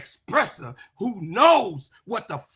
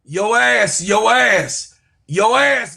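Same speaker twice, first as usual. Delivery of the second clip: heated shout; 3 semitones lower, 8 dB louder, slower than usual. Real, not acted. Heated rant at a rival analyst.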